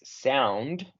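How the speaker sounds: "sound" said slowly, ou as an ow glide; slow model word. Shown as a demonstration. Teacher modelling "sound" slowly for the student. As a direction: stretch out "sound" with an ow diphthong. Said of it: "en inglés".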